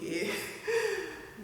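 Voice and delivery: rueful laugh. Real, not acted. Laughing at her own naivety.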